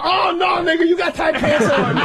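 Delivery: high, laughing taunt; mocking holler. Heckler topping the mom joke, high and laughing. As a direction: shout it down with a laugh. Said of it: "And you're like".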